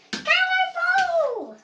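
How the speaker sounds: long high shouted cannonball; cannonball yell. Pretend. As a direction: belt it out as you jump. Kid voicing doll jumping in.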